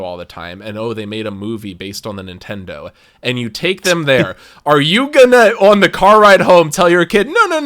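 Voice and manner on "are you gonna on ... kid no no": loud, high, flustered parent backpedaling; mock panicked parent insisting it was the game; pretend parent. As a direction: plead with your kid in the car, loud and high. Mock parent pleading with kid.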